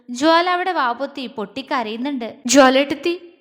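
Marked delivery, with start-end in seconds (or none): 2.5-3.3 loud call after someone; raised-voice summons. Acted. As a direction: call the name out sharply louder. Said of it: "Meenu വിളിച്ചു".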